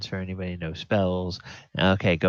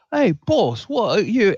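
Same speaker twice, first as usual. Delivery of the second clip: high, loud sleepy creature call; a just-woken minion calling his boss; groggy goblin; 14 semitones higher, 7 dB louder than usual. Acted. Danish waking up and calling to his boss, high and loud. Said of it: "goes to turn around and go".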